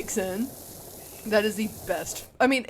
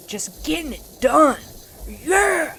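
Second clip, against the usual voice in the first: loud, high hype shouting; excited cheering; 10 semitones higher, 13 dB louder, slower than usual. Real, not acted. Cheering herself on cleaning.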